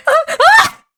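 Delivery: very high, loud scream; screaming at a sudden fright; startled shriek. Real, not acted. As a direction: yelp at the jump scare. Another jumpscare.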